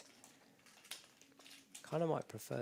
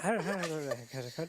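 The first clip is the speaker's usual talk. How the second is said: put-on Irish accent; 2 semitones higher, faster than usual. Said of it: "Maybe in an Irish accent".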